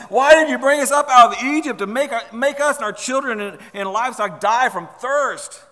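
high, loud protest easing in volume; mock complaining. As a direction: act out an indignant crowd's complaint. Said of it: "they can keep on complaining".

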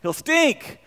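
sudden loud high protest; Martha objecting. Acted. Martha refusing when Jesus says take the stone away, loud and high. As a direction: blurt out a loud shocked no. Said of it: "she says".

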